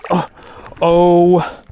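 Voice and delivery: high, drawn-out awed exclamation; stunned amazement. Real, not acted. Angler staring at a huge walleye, stunned amazement. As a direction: break it into long, astonished words.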